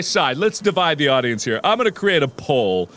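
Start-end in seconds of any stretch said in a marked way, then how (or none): none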